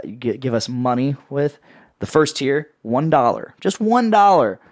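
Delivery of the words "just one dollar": excited pitch. Pretend. Infomercial pitch emphasis.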